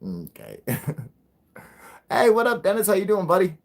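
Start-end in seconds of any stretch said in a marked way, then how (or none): 2.1-3.6 high, loud friendly call-out; 1 excited greeting. Real, not acted. Greeting his buddy Dennis as he arrives, high and loud.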